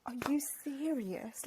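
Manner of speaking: unvoiced, slow whisper of disbelief. Friend forgets her book, she whispers in disbelief. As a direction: breathe it out in hushed shock. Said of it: "And I was like".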